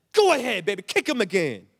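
high, loud whooping encouragement; retold cheering. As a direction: egg her on at a yell. Retold cheering on daughter's kick.

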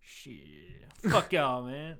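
mock a Southerner brushing you off; imitated Southern response. Teased Southerner giving up and leaving, imitated Southern dismissal. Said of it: "it's a very Southern response".

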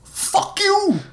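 high, strained shout; high-pitched angry retort; shouting back. A narrator's reading. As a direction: fire it back as a high yell. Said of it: "the doctor shouted back".

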